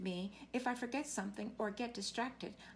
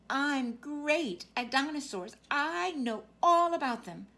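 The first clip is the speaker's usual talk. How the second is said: louder, slow and proud; excited boast; 2 semitones higher, 7 dB louder, slower than usual. Acted. Child boasting to listener about dinosaurs, excited and loud. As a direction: show off what you know, loud and slow.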